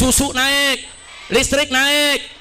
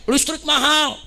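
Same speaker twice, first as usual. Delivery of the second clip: higher voice, imitating complaining mothers; mild retold cry of protest; mothers' mock cry; 5 semitones higher, slower than usual. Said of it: "ibu-ibu teriak nih". (tiruan seruan ibu-ibu)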